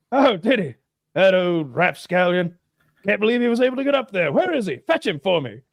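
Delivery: high, theatrical English indignation; hammed-up lordly grumbling; blustering Englishman. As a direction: play the huffy master giving orders. Acted reply of the blustering Englishman.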